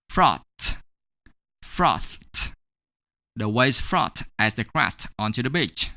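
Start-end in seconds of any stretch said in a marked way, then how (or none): 0.1-2.5 "frothed" in one syllable ending "tht". Shown as a demonstration. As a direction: finish "frothed" on a released voiceless t. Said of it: "we're gonna pronounce it as".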